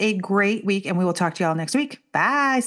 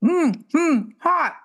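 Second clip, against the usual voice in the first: mouth full of hot food; much slower than usual. Real, not acted. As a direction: wince and hum past a scalding sip. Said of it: "never to make that sound again".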